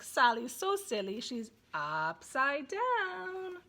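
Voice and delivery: very slow, stretched upside down; drawn-out storytelling. Shown as a demonstration. Parent reading Silly Sally, in a slow drawn-out voice. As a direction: stretch the words out for the little one. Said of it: "I might just turn the pages and say".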